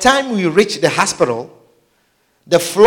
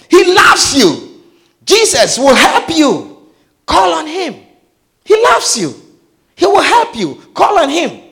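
high, urgent pleading; earnest paramedic; 7 semitones higher than usual. Retold or imitated. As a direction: beg him to believe, insistent. Paramedic pleading with dying man.

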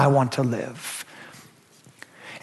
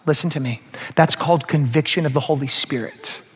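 ordinary delivery

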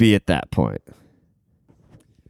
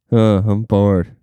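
put-on slow, sighing bored kid; 3 semitones lower, much slower than usual. Remembering being a bored kid, slow sighing. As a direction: let it drag out of you. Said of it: "when I'd just be like".

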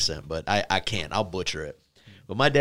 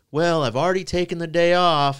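higher, louder imitation of mom objecting; mock protest; 7 semitones higher, 5 dB louder than usual. Mom's protest, retold by son.

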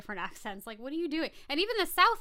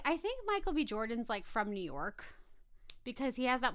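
usual speaking voice

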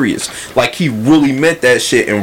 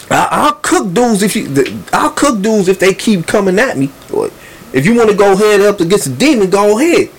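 raised pitch, spoiling for a fight; Lupe talking spicy; 9 semitones higher than usual. Retold or imitated. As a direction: imitate a heated, provocative challenge. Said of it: "talking real spicy".

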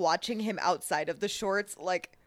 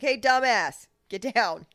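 loud mock yell, higher at first; dad yelling; 4 semitones higher, 6 dB louder than usual. Imitating her dad yelling at someone on the bow. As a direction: bark it up at the fool on the boat. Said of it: "I could definitely see my dad being like".

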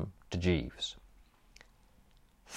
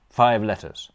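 ordinary delivery

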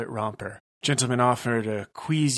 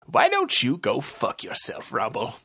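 detective voice. Acted. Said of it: "Bront asked".

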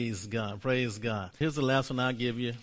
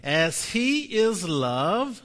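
slow and louder, higher start; slow proclaimed confession; 5 semitones higher, 6 dB louder, much slower than usual. Shown as a demonstration. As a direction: lead the church in it slowly. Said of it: "Say this with me".

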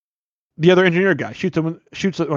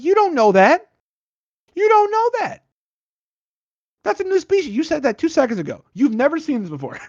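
much higher, exasperated put-on voice; incredulous mock protest; 11 semitones higher than usual. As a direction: argue with the scientist in a high, disbelieving squeak. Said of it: "I'm like".

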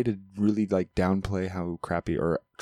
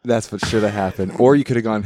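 usual speaking voice

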